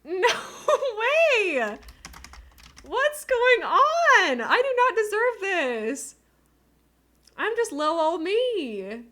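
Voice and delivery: very high, loud, slow and drawn out; teary high disbelief; overwhelmed squeal. Real, not acted. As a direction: stretch it out in stunned joy. Overwhelmed by gifts.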